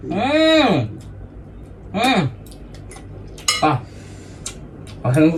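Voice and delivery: coughing on spicy food; sputtering after a bite; choking on noodles. Real, not acted.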